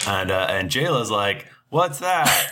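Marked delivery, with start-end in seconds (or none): 1.7-2.5 high-pitched character question; Jayla. Acted. Game master voicing Jayla asking, high-pitched character voice. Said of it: "I'm doing Jayla now".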